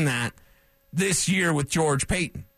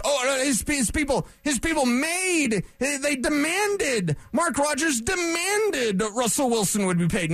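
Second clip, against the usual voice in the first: high, drawn-out mock of team-friendly gossip; 8 semitones higher than usual. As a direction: sneer at the rumor mill in a lifted voice. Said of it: "what did we hear".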